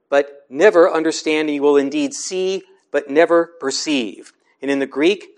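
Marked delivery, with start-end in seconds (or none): none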